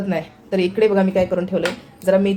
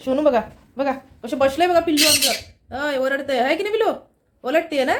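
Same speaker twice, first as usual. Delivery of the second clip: cooing at a pet; 6 semitones higher than usual. Real, not acted. Vlogger shows off the little pet, cooing. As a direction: coo it high, stretching the words.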